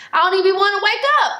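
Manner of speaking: fast, squealed delight; excited rush. Real, not acted. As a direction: blurt it out thrilled. Gushing about epic dreams.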